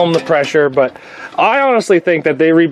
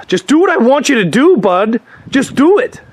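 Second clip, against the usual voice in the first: pleading with machine; 7 semitones higher than usual. Real, not acted. Pleading with a stubborn lift.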